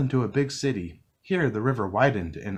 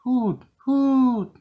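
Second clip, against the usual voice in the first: high, louder hooting owl call; 5 dB louder than usual. A narrator's reading. Owl calls out in the dark woods, high hooting. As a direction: hoot like an owl in the night. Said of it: "an owl called out".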